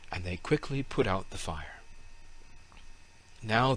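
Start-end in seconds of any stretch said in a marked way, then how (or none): none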